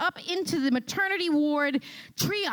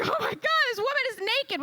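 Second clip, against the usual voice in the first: shocked onlookers; 11 semitones higher than usual. Retold or imitated. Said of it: "everyone's like".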